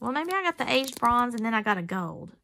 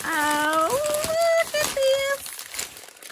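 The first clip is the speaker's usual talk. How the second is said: high drawn-out squeal of delight; 3 dB louder, much slower than usual. Real, not acted. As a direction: coo over the find, very high and slow.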